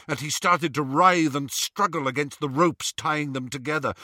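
ordinary delivery, nothing marked

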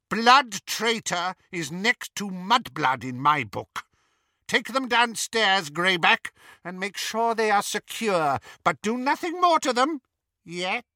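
high, imperious menace; menacing command. A narrator's reading. Bellatrix sending prisoners below with Greyback, high menace. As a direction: give orders with a threat held back.

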